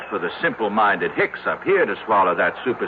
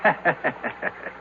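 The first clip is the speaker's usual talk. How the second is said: short scoffing laugh; 3 semitones higher, 6 dB quieter than usual. Acted. Doctor scoffs at rational explanation.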